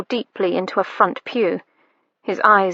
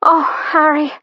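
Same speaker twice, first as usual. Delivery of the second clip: higher, louder sighing cry of his name; lady crying out her lover's name; 6 semitones higher, 4 dB louder than usual. A narrator's reading. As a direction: let his name spill out in a sigh. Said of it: "his lady sighed".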